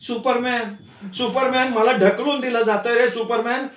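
high pleading cry for help; desperate call. A narrator's reading. Unseen voice pleading with Superman for help. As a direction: call out as if being pushed away. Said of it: "आर्त हाक".

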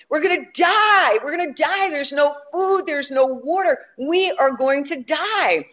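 high, panicked mock shout that settles; hammed-up castaway yelling in panic. Shipwrecked man screaming at his friend in panic. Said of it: "started screaming and yelling".

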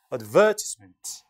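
advertisement as "ad-VER-tis-ment", rising; British pronunciation. Shown as a demonstration. Teacher showing students British advertisement stressed on VER. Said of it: "이게 영국식 발음입니다".

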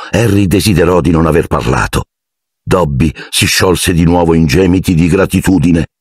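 usual speaking voice

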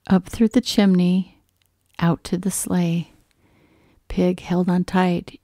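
ordinary delivery, nothing marked

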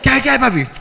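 butter him up like a street hustler; street clown greeting. Retold or imitated. The mime greeting the passerby, chummy.

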